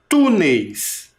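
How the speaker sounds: Túneis punched on TÚ, then falling; stress on TÚ. Shown as a demonstration. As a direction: lean on the TÚ of Túneis. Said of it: "Identificou a sílaba tônica?". (Túneis destacando o TÚ tônico)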